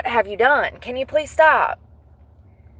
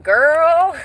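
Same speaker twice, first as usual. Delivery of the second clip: put-on sassy girl; 7 semitones higher, 3 dB louder than usual. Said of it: "and I was like".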